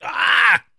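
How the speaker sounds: high, loud cry of disgust; loud gasp after shot. Real, not acted. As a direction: let out a sharp "ah" after a bad drink. Reacting to a shot of liquor.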